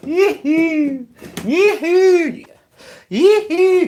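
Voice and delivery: high, loud cowboy whoop, thrice; high yee-hoo whoop. Real, not acted. Mocking cheer at troll.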